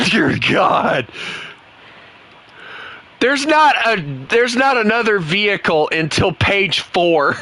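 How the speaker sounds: high, slow, strained exasperation; like you can't believe what you're seeing; exasperated disbelief. Real, not acted. Reacting in disbelief to opponent's tanks.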